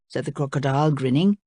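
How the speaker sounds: plain reading voice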